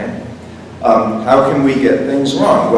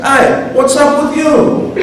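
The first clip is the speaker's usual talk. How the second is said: high, drawn-out Italian American challenge; hammed-up Italian American accent; slower than usual. Shown as a demonstration. Virgil challenging Dante, in an Italian American accent. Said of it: "with an Italian accent, an Italian American accent".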